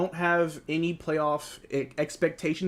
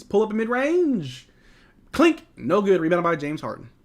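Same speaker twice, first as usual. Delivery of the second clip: quick, lively game call; broadcast-style play-by-play; 4 semitones higher, 3 dB louder than usual. Acted. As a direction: call the action as it happens. Calling the game live.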